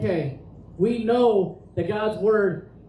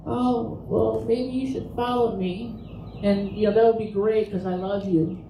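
lightly mocking, mild-mannered voice; understated send-up of a meek, casual plea; mild-mannered Jesus parody. Preacher parodying a casual Jesus for doubters, mild-mannered. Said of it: "just kinda like".

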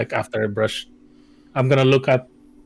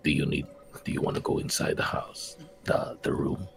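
hushed, breathy unvoiced whisper; very soft, halting whispered question; 10 semitones lower, 9 dB quieter than usual. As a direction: whisper it secretively to a companion. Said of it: "and then whisper to him".

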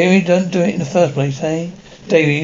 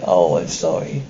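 tearful apology; 12 semitones lower, 3 dB quieter than usual. Acted. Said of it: "Don't cry, Mum".

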